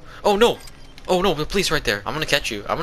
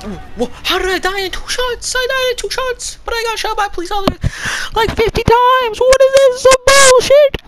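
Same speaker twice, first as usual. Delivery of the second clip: much higher, growing louder, indignant whine; squeaky disbelief building to a yell; high-pitched complaint rising to louder outrage; high outraged squeal; 7 dB louder than usual. Real, not acted. Outraged after dying in game.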